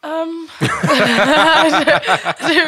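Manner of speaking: loud laughing reply. Real, not acted. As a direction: laugh through the answer. Laughing at iPad remark.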